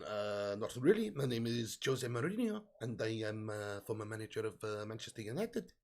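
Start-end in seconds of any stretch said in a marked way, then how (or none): 1.1-5.7 a hint of a Portuguese accent, lower and softer; a lightly tried Mourinho, half-hearted; low, quiet mock Mourinho accent; Jose Mourinho impression. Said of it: "Can I do a Jose or Emre or Jose impression?".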